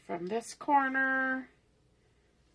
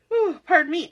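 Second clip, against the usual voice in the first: yawning; 6 semitones higher, 7 dB louder, faster than usual. Real, not acted. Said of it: "I'm yawning".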